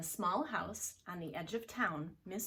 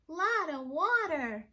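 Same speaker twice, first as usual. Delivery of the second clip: put-on high, loud, slow baby shout; a cub shouting the alarm; loud high baby bear shout; 9 semitones higher, 9 dB louder than usual. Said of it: "shouted Baby Blair".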